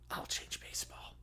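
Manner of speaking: whispered, scheming; sinister whisper. Acted. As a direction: breathe it like a secret evil plan. Villainous closing line, whispered.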